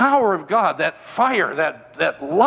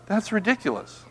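normal talking voice

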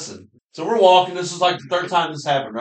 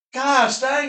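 mock hunter; 11 semitones higher than usual. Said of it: "He's like".

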